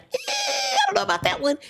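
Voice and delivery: tiny, very high doubtful squeal; squeaky doubt. Retold or imitated. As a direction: squeak out a comic, reluctant objection. Retelling doubtful reaction to granny's advice.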